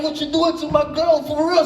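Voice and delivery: high, puffed-up tough-guy challenge; fake tough guy. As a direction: act tough you are not. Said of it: "tried to play like, something like I'm not".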